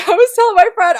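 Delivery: usual speaking voice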